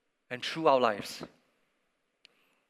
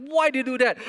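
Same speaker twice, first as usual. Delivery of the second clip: high-pitched, louder mock outburst of an upset boy; angry yell; 9 semitones higher, 4 dB louder, faster than usual. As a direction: throw out a frustrated child's yell. Angry boy yelling at sister.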